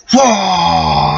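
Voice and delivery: a wide-eyed whoa as he takes off; amazed whoa. Retold or imitated. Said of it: "I was just like".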